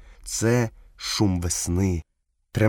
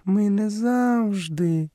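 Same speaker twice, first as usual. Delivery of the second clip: very high, slow panther voice; panther character; 12 semitones higher, much slower than usual. A narrator's reading. Panther starts excusing herself to Mowgli, high and slow. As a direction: stretch out a high, hedging reply.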